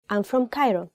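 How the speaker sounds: normal talking voice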